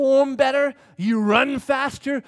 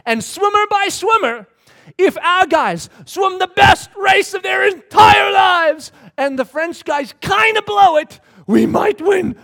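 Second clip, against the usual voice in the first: high, loud, hammered-out delivery; emphatic mock commentary; 9 semitones higher, 8 dB louder than usual. Commentator writing off the American swimmers, loud mock pronouncement. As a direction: exaggerate every point at full voice. Commentators' doubts acted out with rising force.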